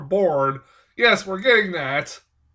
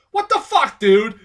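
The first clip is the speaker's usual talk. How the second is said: mock outraged disbelief; 3 semitones higher, 7 dB louder than usual. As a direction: play the buddy's shocked outburst. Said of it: "the most realistic reaction".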